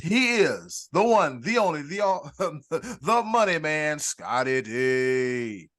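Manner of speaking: slow, drawn-out ring announcer; grand slow intro. Acted. Host introducing co-host Scotty D, slow grand announcer call. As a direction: stretch every word like a title fight.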